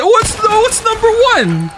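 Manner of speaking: high, fast squeaky mock outrage; hammed-up high-pitched indignation; mock outraged chatter. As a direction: play an indignant chatter in a shrill falsetto. Mock outraged reply to chat.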